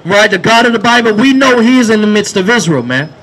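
loud, driving sermon shouted to the street; full-force street preaching. Acted. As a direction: preach it out over the crowd.

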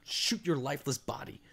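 whispered venom; hushed, low threat under the breath; seething whisper. Real, not acted. Gamer upset at a fallen enemy, seething whisper. Venting at slain game enemy.

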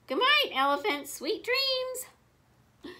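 high, lilting good-night call; voice from moon. A narrator's reading. Wilbur throws his voice from the moon, high singsong farewell. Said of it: "A voice that seemed to come from the moon".

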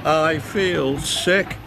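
high, slow sickly wail. A narrator's reading. Diesel's engine splutters on bad fuel, wailing. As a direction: wail it miserably, long and drawn out. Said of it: "wailed Diesel".

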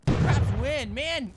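loud, high cry of frustration; frustrated shout; angry outburst. Real, not acted. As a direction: yell it in exasperation at losing. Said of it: "Oh, I'm so angry".

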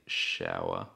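"shower" with a soft sh, no ch; "shower" opens with sh and no stop. Shown as a demonstration. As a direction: start "shower" with a long shh. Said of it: "es diferente".

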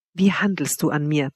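plain reading voice

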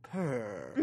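low, soft spoken "purr"; cat saying "purr". Retold or imitated. Cat being petted by Molly, low spoken purr. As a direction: say the word instead of purring. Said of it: "it says the word purr".

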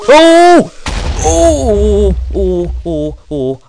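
very high, very loud shocked cries; startled yelps. Real, not acted. Gamer jumping at something in a cave, shrieking. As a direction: yelp in sudden fright.

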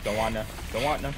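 panicked repeated refusal while fleeing; nervous, pleading nopes; being chased. Real, not acted.

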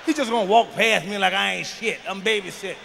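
ordinary talk

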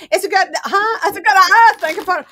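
loud, high attempt at a Scottish accent; badly faked Scottish, breaking off in dismay. Trying out a line for viewers, bad Scottish accent. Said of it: "my Scottish accent is so bad".

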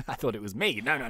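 ordinary delivery

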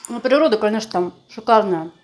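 talking while chewing; muffled chewing speech; mouth full of dumpling. Real, not acted. Seen 0: Woman bites dumpling at -2, cheek bulges chewing while speaking.

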